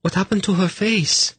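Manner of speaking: put-on very high, horrified girl's voice. A narrator's reading. Girl asking about the priestess's face, horrified. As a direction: squeak it out in horror. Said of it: "asked in a horrified voice".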